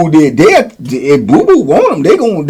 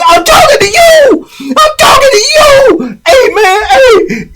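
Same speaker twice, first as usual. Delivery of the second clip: very high, loud strained yelling; imitated angry shout; 5 dB louder than usual. Imitating Charles confronting a man on the yard, high angry shout. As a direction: mimic a man hollering at someone.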